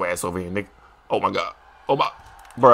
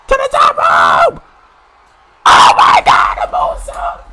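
a much higher, louder scream of disbelief; shrieking hype; 7 dB louder, much slower than usual. Real, not acted. Gamer screaming as his wrestler moonsaults, shrieking. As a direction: scream it like a fan losing it.

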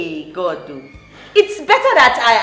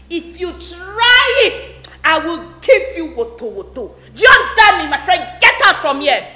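angry shout; 5 dB louder than usual. Acted. Stepmother threatening her stepdaughter, shouting her out. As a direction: drive her out of the room.

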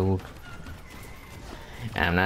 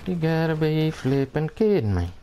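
exasperation; 6 semitones higher than usual. Real, not acted. Exasperated at the Grump again.

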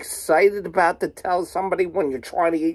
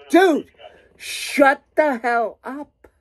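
exasperated retort; 5 semitones higher, slower than usual. Retold or imitated. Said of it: "I'm like".

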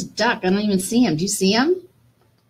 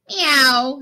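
cat meow; 5 semitones higher, 4 dB louder than usual. Acted. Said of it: "said Cat".

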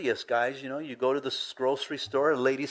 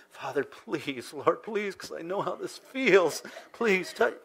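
acted plea, pitch climbing high as it goes; pleading prayer; 5 semitones higher, slower than usual. Praying for someone's back pain, acted heartfelt pleading. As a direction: plead with God like you know the pain.

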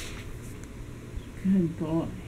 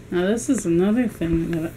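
ordinary delivery

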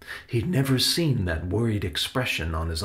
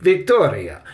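protesting interjection; 8 semitones higher, 8 dB louder than usual. A narrator's reading. Salvador tries to interrupt her.

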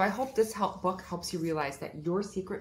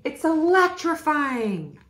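higher, louder, slow and drawn out; over-the-top excitement; electrified; 10 semitones higher, 12 dB louder, slower than usual. Acted. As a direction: stretch it out with mounting thrill. Character voice builds excitement.

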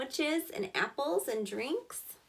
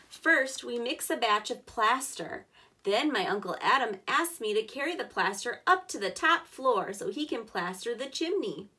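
louder, clear storybook reading; reading to children; 5 dB louder than usual. Acted. Teacher reading the boy's workday to kids, louder storybook voice. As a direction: give every step of the workday a clear read. Reads picture book aloud.

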